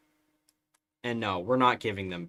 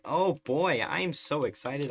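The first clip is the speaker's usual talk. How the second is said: mock excitement; 6 semitones higher, 7 dB louder than usual. Sarcastic excitement.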